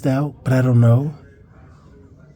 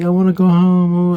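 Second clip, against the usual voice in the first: higher, louder mock wail; imitated crying plea; 6 semitones higher, 5 dB louder than usual. Injured sister begging to go home, imitated crying plea. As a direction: lightly act out a hurt child's plea. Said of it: "laying on the ground crying".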